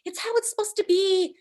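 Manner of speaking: high, put-on voice mimicking the husband; mock husband. A wife mocks her husband's soothing words, high voice. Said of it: "My husband's just like".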